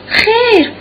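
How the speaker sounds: a sudden high, loud cry of no; startled no. Acted. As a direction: burst out in alarm to deny it. Darya's startled denial. («خیر» با فریادی ناگهانی و بلند)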